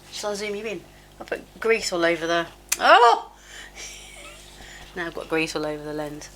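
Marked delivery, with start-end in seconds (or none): 2.8-3.3 high, loud "oh!" of surprise; sudden high-pitched yelp at a mishap; startled yelp. Real, not acted. Startled at greasing the camera lens.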